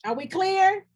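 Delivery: are we clear, said up and loud; pointed challenge. Real, not acted. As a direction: call the room to account. Preacher challenging audience.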